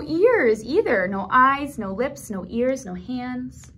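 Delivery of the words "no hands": ordinary delivery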